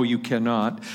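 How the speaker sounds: normal talking voice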